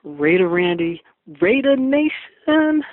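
loud, rising call, last word drawn way out; loud, slow rallying call to the fans; excited shout-out. Real, not acted. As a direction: hype the crowd with a stretched-out chant. Caller's excited on-air greeting shout.

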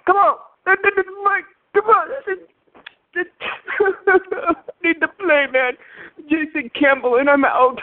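high-pitched, hammed-up sobbing plea; badly faked tearful begging; fake crying. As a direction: whine and blubber it in mock despair. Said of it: "I don't think Jason Campbell cries".

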